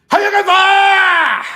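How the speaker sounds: a hammed-up samurai war cry; dramatic shout. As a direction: bellow the name like a samurai. Announcing a comic title theatrically.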